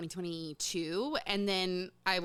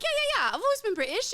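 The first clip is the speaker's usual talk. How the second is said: mock British accent; 14 semitones higher, 5 dB louder, much faster than usual. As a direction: brush it off in a parody British accent. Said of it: "[name] suddenly showed up with a British accent".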